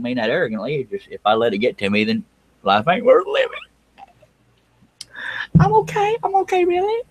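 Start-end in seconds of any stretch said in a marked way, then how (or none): 2.9-7.0 very high, mock tearful squeal; fake crying. Host joking that criticism gets to him, fake crying. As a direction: pretend to break down, voice shooting way up. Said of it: "I'm not really crying".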